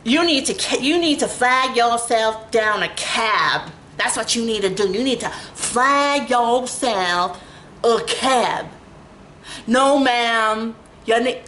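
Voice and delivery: slow, bossy, talking down; mock rude dispatcher. Mimicking the cab dispatcher who orders her to flag a cab. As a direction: lecture her like a curt dispatcher. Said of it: "the attitude".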